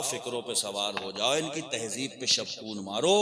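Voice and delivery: hushed-down, low-pitched explanation; confiding sermon. Real, not acted. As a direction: let the crowd in on a secret, low and measured.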